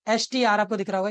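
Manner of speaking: ordinary talk, nothing marked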